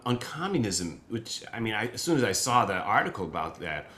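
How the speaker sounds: ordinary talk, nothing marked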